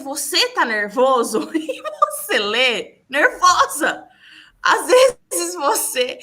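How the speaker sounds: voz aguda e alta, entre risos; rindo alto. Real, not acted. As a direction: fale rindo, subindo o tom e o volume. Conta rindo como se lê nervosa. (rindo, exaltada)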